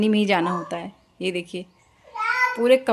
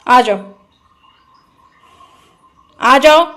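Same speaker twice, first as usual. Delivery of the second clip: loud call to come; 2 semitones higher, 8 dB louder than usual. Real, not acted. Mother coaxing her toddler out of the water, calling loudly.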